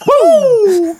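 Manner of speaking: high, loud wrestler's whoop; Ric Flair. Acted. Podcaster breaking into a wrestler impression for friends, high showboating whoop. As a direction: let out a piercing, drawn-out showman's woo. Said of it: "That was nineteen eighties Ric Flair".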